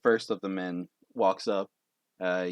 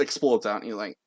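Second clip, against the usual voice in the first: ordinary talk, nothing marked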